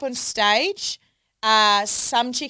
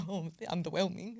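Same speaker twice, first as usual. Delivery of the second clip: muttered aside; 3 semitones lower, 11 dB quieter than usual. Retold or imitated. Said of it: "I was like".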